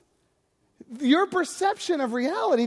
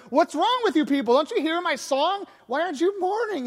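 raised, high scolding; indignant kid; 6 semitones higher, 3 dB louder than usual. Pretend. Flute-playing kid scolds people not mourning, indignant shout. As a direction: scold them for not mourning, mock-outraged.

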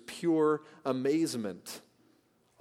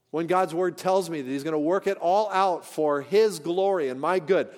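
ordinary talk

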